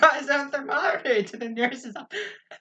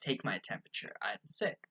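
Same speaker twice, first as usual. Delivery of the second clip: much lower, softer pompous voice; hushed, lowered mock-grand patient; 16 dB quieter than usual. Pompous kid voice mocked, very low.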